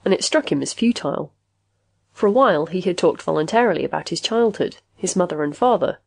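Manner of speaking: usual speaking voice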